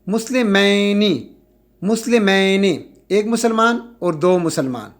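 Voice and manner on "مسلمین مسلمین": مسلمین drawn out very slowly, twice; slow demo. Shown as a demonstration. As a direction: hammer the ending ین slowly. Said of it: "زبر لگا لیے". (آہستہ دہرا کر ادائیگی)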